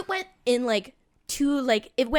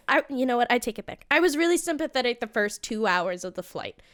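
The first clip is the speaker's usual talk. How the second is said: ordinary talk, nothing marked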